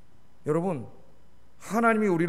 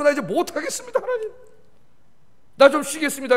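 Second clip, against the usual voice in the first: higher, strained plea to God, rising on the name; weary prophet pleading; 7 semitones higher, 4 dB louder than usual. Retold or imitated. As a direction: beg to stop like a worn-out Jeremiah. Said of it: "너무 힘들어갖고".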